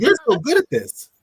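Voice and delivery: high, hesitant fake praise; overly kind friend. Coach mimicking a too-nice friend praising bad potatoes, high and hesitant. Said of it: "So they go".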